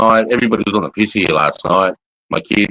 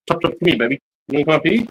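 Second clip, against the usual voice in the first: higher sing-song pet talk; talking sweetly to the dog, higher pitched; coaxing a pet; 6 semitones higher, much faster than usual. Real, not acted. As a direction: coax the puppy up, higher. Calling the dog up to him.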